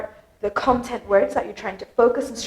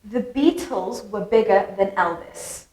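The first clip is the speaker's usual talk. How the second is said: slow model sentence, Beatles, bigger, Elvis picked out; stressed content words; 3 semitones lower than usual. Shown as a demonstration. English teacher demonstrates sentence stress to learners, stressing Beatles, bigger, [name]. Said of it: "those were the words that I stressed".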